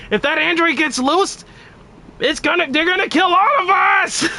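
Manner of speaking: high frantic scream, breaking into a cry; hysterical Trunks. Acted. Streamer performing Trunks' meme line as a shrieking panic. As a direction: shriek it like the world is ending. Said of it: "the way Trunks".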